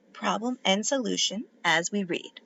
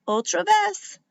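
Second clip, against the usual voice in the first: cheering; 9 semitones higher, 5 dB louder than usual. A narrator's reading. Cheering his sister on.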